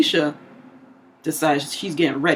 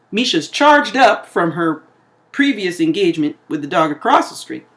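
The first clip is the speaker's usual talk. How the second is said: ordinary talk, nothing marked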